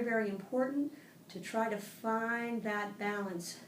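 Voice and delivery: usual speaking voice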